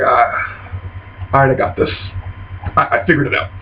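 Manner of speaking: soft, low mock-dazed murmur; weak recovery. Londo lies knocked down in blood, weakly recovering. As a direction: groan softly, then gather yourself.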